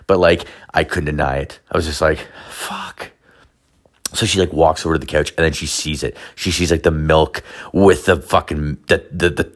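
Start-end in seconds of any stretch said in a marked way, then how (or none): 2.5-3.1 a defeated whispered curse. Retold or imitated. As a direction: breathe the word out, resigned. Caught, inner curse.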